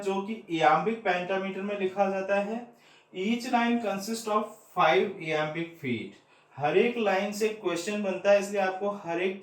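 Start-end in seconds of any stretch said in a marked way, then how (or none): 3.2-6.1 very slow, word by word, as if for notes; slow dictation. Shown as a demonstration. Teacher to exam students, dictating the rule very slowly. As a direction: spell it out slowly so students can write.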